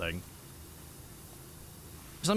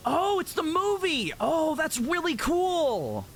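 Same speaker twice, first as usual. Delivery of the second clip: excited surprise; 9 semitones higher, slower than usual. Real, not acted. Surprised discovery in game.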